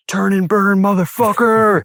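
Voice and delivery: high, loud shout; fierce shouted encouragement; buddy's yell. Retold or imitated. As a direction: yell it to send him into the wave. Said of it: "he goes".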